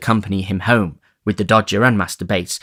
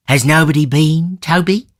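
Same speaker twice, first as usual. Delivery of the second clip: put-on higher, louder old man's question; 8 semitones higher, 5 dB louder than usual. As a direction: ask Toby eagerly.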